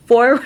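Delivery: "Forward" loud, rounded, as round a golf ball; golf-ball mouth. Shown as a demonstration. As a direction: say "Forward" with a hollow, full mouth. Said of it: "pretend that you have a golf ball".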